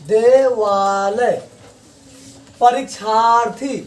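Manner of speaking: देवालय stretched out slowly syllable by syllable; slow demo pronunciation. Shown as a demonstration. Teacher stretching देवालय slowly for the class. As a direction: drag out देवालय to show the long आ. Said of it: "उसी प्रकार देवालय".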